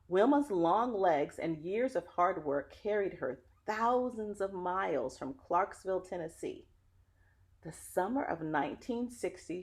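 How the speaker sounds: put-on lively read-aloud voice for children; story narration. Reading a picture book to children, lively storyteller. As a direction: tell the story warmly to young listeners.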